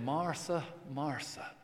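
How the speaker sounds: soft, tender double call; gentle rebuke. Acted. The Lord gently rebuking Martha, soft tender call. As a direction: rebuke her gently and quietly. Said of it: "that gentle rebuke to Martha".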